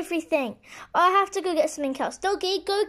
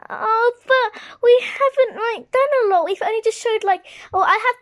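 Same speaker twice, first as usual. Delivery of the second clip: higher, louder whiny protest; protesting student; 6 semitones higher, 7 dB louder than usual. Pretend. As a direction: complain loudly that it isn't fair. Pretend student protesting to teacher.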